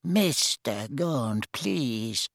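soft, slow shocked protest; quietly appalled, drawing out the words. A narrator's reading. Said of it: "said Ogden in a shocked voice".

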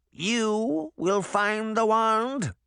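high, very slow witch voice; sceptical witch. Acted. Witch eyeing Granny skeptically, high drawn-out question. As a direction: stretch the question out, high-pitched.

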